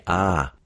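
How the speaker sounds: "ah" as an intermediate A, between open and flat. Shown as a demonstration. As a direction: model "ah" as an in-between A. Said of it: "that sort of intermediate A".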